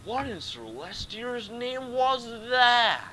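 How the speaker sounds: high, loud, drawn-out shock; theatrical alarm. Acted. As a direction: exclaim it slowly in hammed-up horror. Acting out startled game line.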